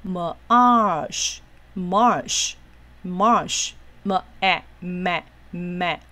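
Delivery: slow separate "marsh", then "ma-", with pauses; teacher blending sounds one at a time; syllable-by-syllable sounding out. Shown as a demonstration. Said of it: "所以我们一个一个来念哦".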